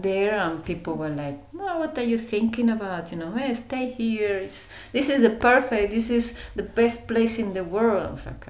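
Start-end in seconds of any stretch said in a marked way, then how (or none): 1.5-8.1 higher-pitched, insistent mock persuasion; imitated hometown urging. Townspeople urging her to stay home, imitated insistent enthusiasm. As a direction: talk them into staying, pitch held up.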